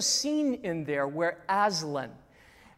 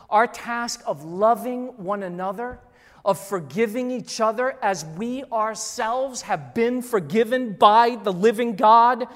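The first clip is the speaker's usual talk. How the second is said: climbing louder to the final word; preaching build; 3 semitones higher, 7 dB louder than usual. Acted. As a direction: stack the tasks and build.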